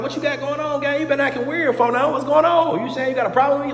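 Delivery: high, pressing confrontation; heated challenge. Retold or imitated. Pressing a roommate who's avoiding him, high heated challenge. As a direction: step to him with pitch way up.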